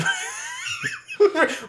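big shrieking laugh. Real, not acted. Laughs at robot's return.

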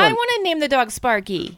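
put-on little girl voice. Continuing child play voice.

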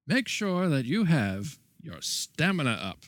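slow, bright vintage announcer; hammed-up retro PSA pitch; chipper 1950s ad.

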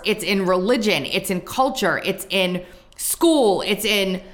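ordinary talk

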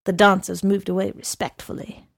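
ordinary delivery, nothing marked

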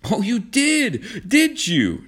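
high, slow, seething challenge; trembling anger. A narrator's reading. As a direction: draw it out, furious and high. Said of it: "voice trembled with anger".

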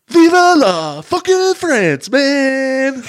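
high, loud and slow hammed-up shout; mock yell. Host imitates Cage's manic yell, high, loud and drawn out. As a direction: bellow it like a crazed patriot. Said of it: "And he yells".

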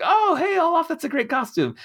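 very high, loud, excited squeal of a student; over-the-top excited student voice; gushing greeting. Retold or imitated. Acted student greeting costumed classmate.

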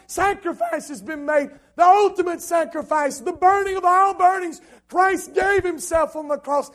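loud, high-pitched sermon climax; full-force preaching. Real, not acted. Preacher testifying about the day of his salvation, full-force preaching. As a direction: build to a shouted, impassioned testimony.

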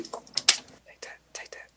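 hushed, whispered catchphrase. Real, not acted. Cohost whispers the catchphrase after the intro, whispered. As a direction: whisper it almost under your breath. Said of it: "I whispered".

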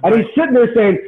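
ordinary talk

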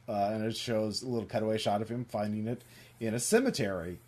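ordinary talk

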